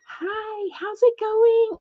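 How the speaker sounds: put-on very high, breathy-free baby voice, slowed on the second part. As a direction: chirp it up high like a paparazzi-ready socialite. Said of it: "I can't even do that high of a voice".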